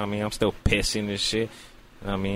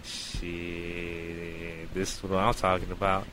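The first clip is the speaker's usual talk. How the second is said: hushed pleasure; 4 semitones lower, 5 dB quieter, much slower than usual. Retold or imitated. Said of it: "And I was like".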